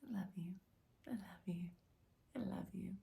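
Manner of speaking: soft breathy I love you; whispered love to a stranger on the street. Shown as a demonstration. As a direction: breathe it out under the voice. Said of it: "just through my eyes".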